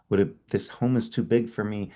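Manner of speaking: ordinary delivery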